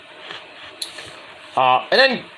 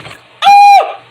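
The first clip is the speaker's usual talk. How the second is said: very high, very loud imitated scream; attempted Sting scream; 11 dB louder than usual. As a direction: let out a wrestler's war cry. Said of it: "I can't do his scream".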